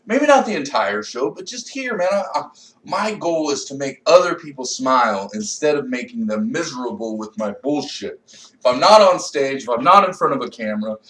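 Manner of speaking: ordinary talk, nothing marked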